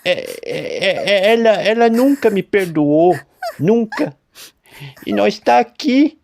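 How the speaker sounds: high, loud, tearful stammer; weeping old farmer; sobbing farmer. A narrator's reading. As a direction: break down in tears. Farmer breaking down.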